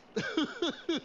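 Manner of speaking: high, silly self-pleased laugh; dopey cartoon giggle; goofy chuckle. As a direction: chuckle at your own joke. Laughs at his own joke.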